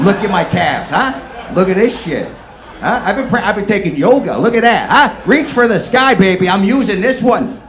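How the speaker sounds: high, loud show-off bravado; loud boasting. Pretend. He shows off his calves to nobody, loud and cocky. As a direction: flex and holler it at the room.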